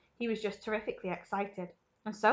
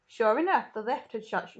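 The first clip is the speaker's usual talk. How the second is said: plain reading voice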